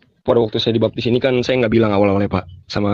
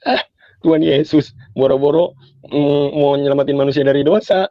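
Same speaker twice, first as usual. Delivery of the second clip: mocking father; 3 semitones higher than usual. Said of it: "masih suka ledek-ledekin saya".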